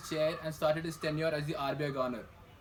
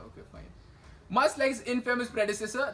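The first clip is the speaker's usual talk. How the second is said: ordinary talk, nothing marked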